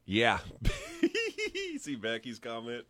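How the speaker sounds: usual speaking voice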